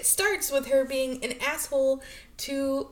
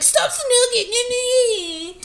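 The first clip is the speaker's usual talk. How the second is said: high, slow whiny complaint; whiny bratty teen; 11 semitones higher, 7 dB louder, slower than usual. Retold or imitated. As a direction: drag it out like a spoiled kid. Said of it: "Don't be like".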